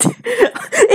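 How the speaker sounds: delighted high laugh; high burst of laughter. Real, not acted. Laughs at guessing right.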